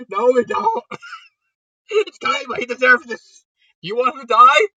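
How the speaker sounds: Joey refusing to help Kaiba, loud and scornful; mock indignant refusal. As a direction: scoff at the idea of saving him.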